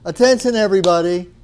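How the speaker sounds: called out high and loud, first word hit hardest. Retold or imitated. Repeating his call to the packed elevator, high and loud. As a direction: hail the whole elevator at full voice.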